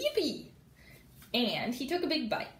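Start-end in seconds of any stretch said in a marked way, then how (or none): 0.0-0.5 high gleeful yippee; cried yippee. Acted. Froggy cheering over the fly pizza, high squeal. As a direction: squeal it with joy. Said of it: "cried Froggy".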